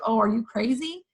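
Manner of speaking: ordinary talk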